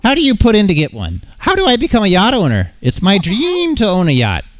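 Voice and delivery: high-pitched, excitable cartoon voice; eager yacht dreamer. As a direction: plead in a squeaky, over-eager character voice. Voices a yacht-obsessed game character.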